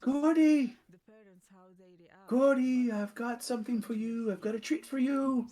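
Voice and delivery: high, far-off calling voice; calling to a monster. Acted. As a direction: coax the creature with a treat. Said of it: "He goes".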